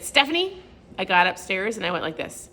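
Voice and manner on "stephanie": high, sharp call of her own name; self-scolding call of "Stephanie"; calling herself out. Retold or imitated. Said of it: "I said".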